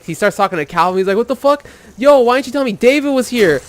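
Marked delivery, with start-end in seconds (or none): 1.2-3.6 high-pitched mock outraged excitement; ecstatic. Friend confronts Calvin over the surprise guest, ecstatic. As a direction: imitate a thrilled friend demanding answers. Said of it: "He's, like, fucking ecstatic".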